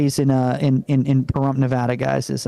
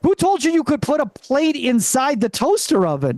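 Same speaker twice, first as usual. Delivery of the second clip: pitched up, stunned at mom's toaster stunt; alarmed question; 6 semitones higher than usual. Retold or imitated. Son catching mom with a plate in the toaster, alarmed. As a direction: gasp it out in disbelief. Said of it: "I'm like".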